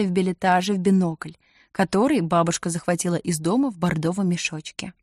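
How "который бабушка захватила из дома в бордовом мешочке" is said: ordinary delivery, nothing marked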